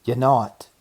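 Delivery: a sharp loud snap; snapped. Acted. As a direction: cut him off hard. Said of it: "snapped the rabbit".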